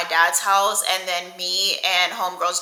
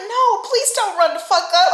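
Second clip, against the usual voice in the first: much higher, louder frantic begging; girl on the floor begging braider, mock panic; mock panicked pleading; 12 semitones higher, 6 dB louder than usual. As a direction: beg her not to start a fight. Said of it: "I'm like".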